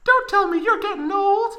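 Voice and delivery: put-on very high teasing girl voice; joking falsetto. Tifa joking with Cloud, high teasing falsetto. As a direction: tease him in a squeaky falsetto. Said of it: "joked Tifa".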